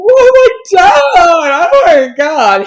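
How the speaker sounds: high, loud crying shout; panicked wail. Retold or imitated. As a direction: wail it, overwhelmed and in tears. Breaks down on seeing the bus.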